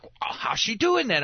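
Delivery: put-on high, wide-eyed little boy asking; amazed kid. Dad imitating his son Jacob, amazed at the ventriloquist video, high wondering kid voice. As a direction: lightly voice a curious young son.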